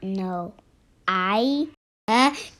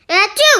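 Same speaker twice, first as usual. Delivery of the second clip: a loud, high explosive achoo; huge sneeze; 10 semitones higher, 11 dB louder than usual. Acted. Gerald sneezes hard at Doctor Cat. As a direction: blast out the sneeze.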